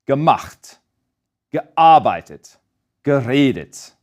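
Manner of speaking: unstressed "ge", then stressed root in Gemacht, gearbeitet, geredet; careful demo of Gemacht, gearbeitet, geredet, stressed just after the prefix; stress on root syllable. Shown as a demonstration. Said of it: "main stress stays on that first syllable of that root verb".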